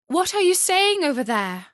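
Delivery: high, loud eager call; crying out. A narrator's reading. Gerda calls to the pigeons, high and loud. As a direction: call across the dark urgently. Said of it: "cried Gerda".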